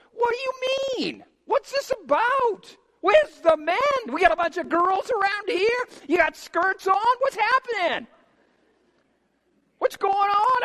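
high, loud disbelieving demand; indignant outburst. Acted. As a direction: shame them for standing by, pitched high. David indignantly challenges the soldiers.

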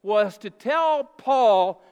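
loud, slow, drawn-out proclamation; booming preacher emphasis; slow emphatic preaching. Real, not acted. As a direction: hammer it home slowly from the pulpit. Preacher driving home the point.

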